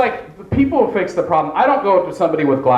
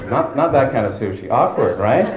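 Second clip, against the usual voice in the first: ordinary talk, nothing marked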